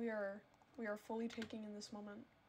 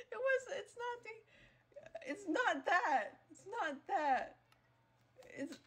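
high, slow words squeezed out between laughs; breathless laughing; 8 semitones higher, 3 dB louder, slower than usual. Real, not acted. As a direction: try to explain while still cracking up.

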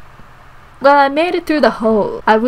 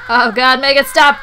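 loud pleading cry, building; mock-desperate, raised voice; desperate plea; 2 semitones higher, 6 dB louder than usual. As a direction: beg loudly for it to end. Pleading during game mishap.

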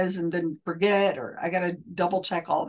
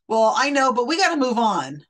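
louder dismissive mock of the psychic, pitched up; 5 semitones higher, 6 dB louder than usual. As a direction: brush the crying sitter off impatiently, mockingly. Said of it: "And he's like".

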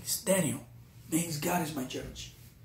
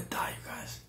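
whisper; 9 dB quieter than usual. Real, not acted. Recalling five terrifying days.

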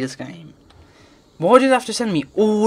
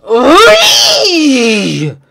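loud high yell; frustrated cry; 8 semitones higher, 12 dB louder than usual. Real, not acted. Gamer crying out in frustration at the game. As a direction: let out a furious cry.

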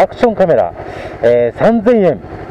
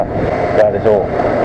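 muffled through a helmet; inside a helmet; 6 semitones lower than usual. Real, not acted. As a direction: voice heard through helmet padding. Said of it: "ヘルメット越しの声になりますから".

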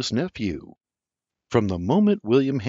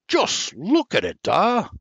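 excited urging; 10 semitones higher than usual. A narrator's reading. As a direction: make him look, excitedly. Junior shoves cloth at father.

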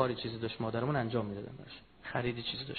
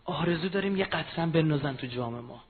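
normal talking voice

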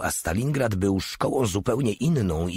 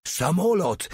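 high roaring cry; 11 semitones higher, slower than usual. A narrator's reading. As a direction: roar it at the sky. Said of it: "ryknął". (głośny okrzyk, wysoko)

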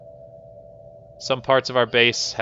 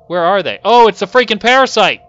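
loud, high cry of alarm; alarmed shout; 9 semitones higher, 11 dB louder than usual. Real, not acted. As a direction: shout it as the threat suddenly appears. Spots the attacking parasite.

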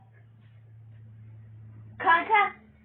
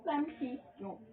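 başka odadan; 9 dB quieter than usual. Real, not acted. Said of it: "Neredesin lan?".

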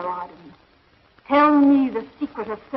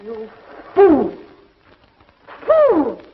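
furious shout; much slower than usual. Acted. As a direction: spit it out in rage. Villain rages at captive.

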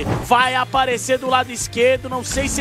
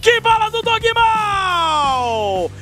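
slow, high excited caster yell; hype shout; 4 semitones higher, slower than usual. Real, not acted. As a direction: roar the play like a caster. Round-winning hype call.

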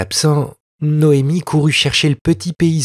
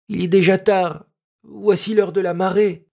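put-on a touch of fear in a high child's voice; the boy urging her home, mildly scared; frightened boy; 8 semitones higher than usual. A narrator's reading. Peasant boy begging Noémie to go back, mild fear. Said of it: "dit le petit paysan effrayé".

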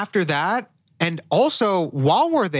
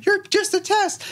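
hammed-up shrieking accusation; mock hysterical shout. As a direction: scream it like she's losing it. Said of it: "She's like".

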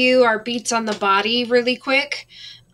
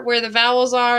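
usual speaking voice

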